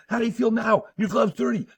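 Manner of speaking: put-on high, loud furious yell at a kid; coach screaming. Little League coach screaming at a boy in the dugout, furious yell. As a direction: scream it down at a nine-year-old, fast. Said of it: "he starts stomping it dirty. He goes".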